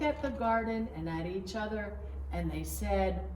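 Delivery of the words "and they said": plain reading voice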